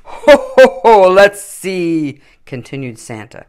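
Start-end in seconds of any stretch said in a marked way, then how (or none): 0.0-2.2 hearty Father Christmas choosing his team. A narrator's reading. Santa's voice in story.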